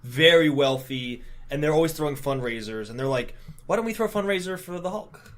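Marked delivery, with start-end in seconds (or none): 3.7-5.3 slightly put-on high society voice; higher-pitched mock socialite; lightly voiced socialite. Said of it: "really upper class, like, Manhattan socialites".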